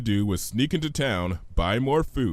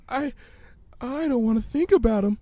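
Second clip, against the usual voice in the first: timid pony voice; 11 semitones higher than usual. Acted. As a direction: voice a shy pony, high. Fluttershy voiced in story.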